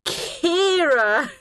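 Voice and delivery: high, drawn-out gleeful cry; high-pitched joint exclamation; chorused. A narrator's reading. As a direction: sing out the name knowingly. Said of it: "they chorused".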